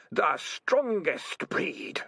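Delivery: slow eerie whisper-like voice; drawn out, cold and sinister; eerie Spider voice. A narrator's reading. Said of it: "said the voice of Spider".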